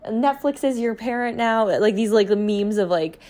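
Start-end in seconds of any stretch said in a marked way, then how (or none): 0.1-1.7 whiny mom complaint. Retold or imitated. Mocking moms on Instagram in a high whine. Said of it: "these moms on Instagram being like".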